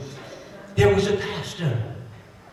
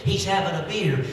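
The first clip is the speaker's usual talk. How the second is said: ordinary delivery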